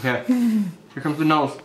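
stiff lips under drying mask; talking while peeling face mask; through a peel-off face mask. Real, not acted. Seen 3: Grey peel-off mask covers face around lips while peeling near brow.

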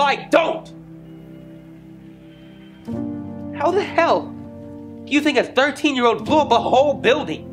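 high-pitched, anguished angry protest; furious outburst. Acted. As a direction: burst in, indignant at the accusation. Acted outburst cutting off accuser.

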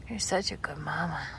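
voiceless whisper, "such" leaned on; tender whisper. Real, not acted. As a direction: whisper the praise right into her ear. Praising mother goat, then whispered.

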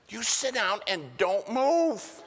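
high, loud, slow command; stern order. Retold or imitated. Preacher orders the woman who kissed him, loud and high. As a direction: plant her in her seat with a sharp order.

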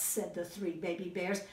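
ordinary delivery, nothing marked

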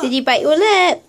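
ordinary talk

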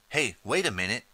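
ordinary delivery

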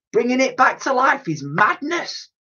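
high, alarmed cry of protest; horrified exclaim. A narrator's reading. Dotty appalled at reviving the mammoth, high alarmed cry. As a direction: recoil at the very idea. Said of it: "exclaimed Dotty".

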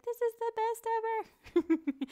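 delighted gush. Retold or imitated. New teacher gushing about a great class, delighted. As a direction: gush like it's the best day ever. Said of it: "you're just gonna be like".

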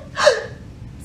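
sharp high gasp; scared gasp. Acted. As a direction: gasp as if frightened. Revealing scared emoji face.

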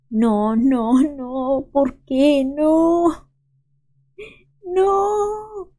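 a real cry of disbelief, pitch soaring by the end; despairing wail. Real, not acted. Gamer crushed by another bad coin toss, wailing in despair.

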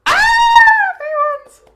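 high, loud shriek of excitement; excited scream. Real, not acted. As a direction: scream it out, thrilled. Screams at nearing summer break.

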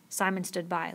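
plain reading voice

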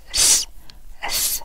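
"th" as soft voiceless air over the tongue tip; tongue lightly between teeth, breathe out "th" twice; isolated th sound. Shown as a demonstration. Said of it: "舌尖轻轻伸出来". (舌尖轻咬，轻声发两次th)

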